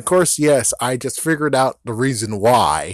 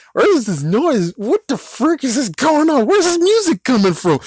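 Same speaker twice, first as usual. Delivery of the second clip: higher, puzzled bystander voice; confused bystander; 10 semitones higher than usual. Retold or imitated. Bystanders at the cookout asking where the music comes from, higher and puzzled. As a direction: voice the baffled guys looking around for the noise. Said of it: "the guys over there like".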